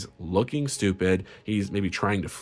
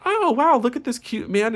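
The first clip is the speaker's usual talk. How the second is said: much higher and louder, gushing falsetto; mock admirer; 14 semitones higher, 4 dB louder than usual. People admiring the ex, hammed-up gushing. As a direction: coo like a fake-smitten fan. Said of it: "they're just constantly like".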